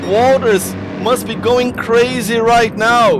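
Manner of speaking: high, loud last-lap call; shouting commentary. Real, not acted. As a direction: yell the finish as if the crowd is on its feet. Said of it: "hear me shouting like this".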